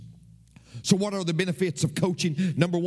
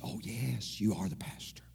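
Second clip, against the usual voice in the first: meek whisper; 9 semitones lower, 9 dB quieter than usual. Retold or imitated. Mimics the singer conceding.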